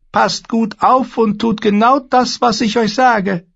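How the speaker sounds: very high, loud, eerie warlock voice; a thin, uncanny sorcerer. Acted. The warlock Pyat Pree bars the way, high and eerie.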